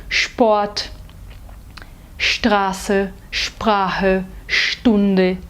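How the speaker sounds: Sport, Straße, Sprache, Stunde said with a full sh at the start; sh sound. Shown as a demonstration. Teacher modelling German st and sp. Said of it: "pronunciados de forma cheia".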